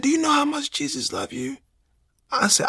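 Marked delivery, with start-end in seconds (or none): 0.0-1.6 higher, softer caring nurse; gentle nurse voice. Retold or imitated. Nurse at his hospital bed offering to pray, high and soft. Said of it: "She was like".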